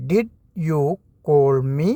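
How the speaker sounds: phrase broken in two with a pause; split into chunks. Shown as a demonstration. Teacher repeating the question for learners, chunked in two. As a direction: model it in two parts. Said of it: "അതെങ്ങനെയാണ് ചോദിക്കുക?".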